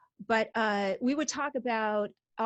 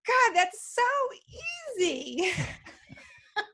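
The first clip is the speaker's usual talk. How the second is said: high, loud exclamation tipping into laughter; mock exasperation; 10 semitones higher, 4 dB louder than usual. Wife replying to her acupuncturist's advice, loudly exasperated in fun.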